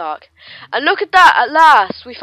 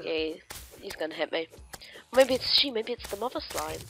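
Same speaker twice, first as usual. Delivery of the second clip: usual speaking voice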